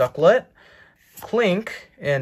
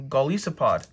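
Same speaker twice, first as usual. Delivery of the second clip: usual speaking voice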